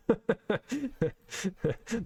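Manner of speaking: high, staccato chuckle at own mistake; sheepish run of chuckles; amused chuckle. Real, not acted. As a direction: laugh at yourself in short, high bursts.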